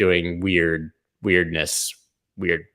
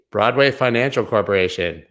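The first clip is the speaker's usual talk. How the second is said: usual speaking voice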